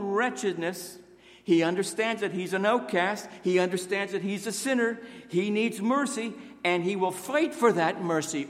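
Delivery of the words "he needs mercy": ordinary talk, nothing marked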